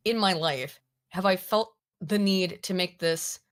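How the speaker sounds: usual speaking voice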